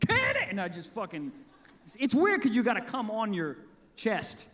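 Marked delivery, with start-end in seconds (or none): 0.1-0.4 high, excited call; high cry. Retold or imitated. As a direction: yell it out up high. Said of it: "I go".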